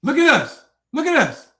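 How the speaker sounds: called out loud, twice, taunting the chat. Real, not acted. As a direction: shout it at the viewers to look. Livestream taunting viewers.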